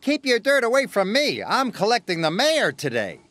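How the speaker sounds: high, put-out character voice; indignant engine. A narrator's reading. Gordon scolds muddy Percy, high and indignant.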